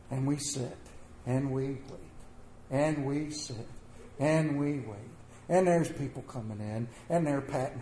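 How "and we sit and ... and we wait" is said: slow and drawn out. A narrator's reading. Slow waiting.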